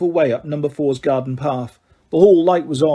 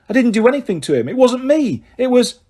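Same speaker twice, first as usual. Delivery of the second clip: higher, fast protest; urgent protest; 8 semitones higher, 4 dB louder than usual. Acted. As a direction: defend yourself quickly.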